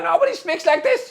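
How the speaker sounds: high, put-on ranting German voice; mock Hitler voice. Shown as a demonstration. Streamer mocking Hitler's speech style, hammed-up. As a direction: show off the dictator voice as a silly mock.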